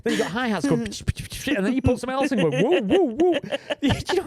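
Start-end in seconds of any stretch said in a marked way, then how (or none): 0.9-1.5 unvoiced pch pch hats; whispered hi-hat noises. Producer mimics hi-hats in a cluttered track, unvoiced ticks. 2.6-3.3 imitated synth woo.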